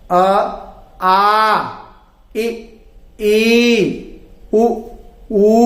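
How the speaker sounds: each vowel drawn out, short ones brief, long ones held; slow recited vowels. Shown as a demonstration. Teacher reciting the Hindi vowels for pupils, held and clear. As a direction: chant the vowel chart for pupils. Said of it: "तो हम बोलना सीखें".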